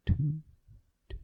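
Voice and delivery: loud voiced mic test; mic check. Shown as a demonstration. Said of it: "two microphones".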